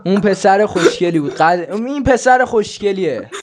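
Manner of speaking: loud playful praise; excited repeat. Shown as a demonstration. Repeated louder, higher.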